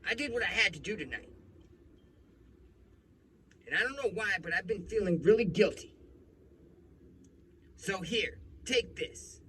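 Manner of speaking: high-pitched, loud impression of the cop; defensive cop. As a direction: insist loudly in a mock cop voice. Retold cop defends himself, offers money.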